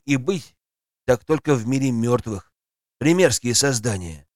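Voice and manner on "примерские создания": plain reading voice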